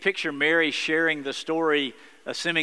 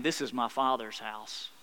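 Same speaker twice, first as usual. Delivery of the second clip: soft declaration; 5 semitones lower, 6 dB quieter than usual. Acted. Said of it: "he's saying".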